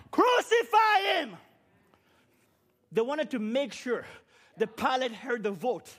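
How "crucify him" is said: a long, drawn-out cry of a mob; crowd crying out. Retold or imitated. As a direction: stretch it out slowly, like a crowd demanding it. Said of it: "they all cried out loud".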